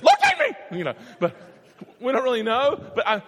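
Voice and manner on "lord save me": a screaming cry for help, pitched way up and much louder; panicked scream. Shown as a demonstration. Peter screams to Jesus as he sinks, panicked. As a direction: Peter drowning, shrieking to the Lord. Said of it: "it could have been like this".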